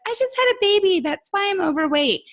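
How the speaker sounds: high-pitched parody of a mother's excuse; whiny excuse-making mom. Acted. Mocking the moms who still blame the baby, high-pitched excuse.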